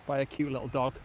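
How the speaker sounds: ordinary talk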